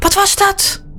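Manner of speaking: high-pitched startled question; acted fright, voice jumping up. A narrator's reading. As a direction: gasp it out high, like a frightened little sister. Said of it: "Sarah schrok".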